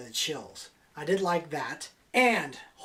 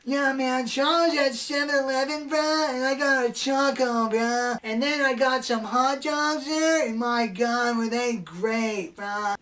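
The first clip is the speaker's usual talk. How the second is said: higher, spaced-out stoner rambling; 6 semitones higher than usual. Retold or imitated. Stoner friend recounting his convenience-store snacks, spaced out. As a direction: lazily ramble like a stoner bro. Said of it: "He jogs like this".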